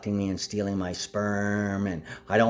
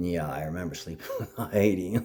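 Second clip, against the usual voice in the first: laughing; 5 semitones lower, 5 dB quieter than usual. Real, not acted. Laughing at own memory.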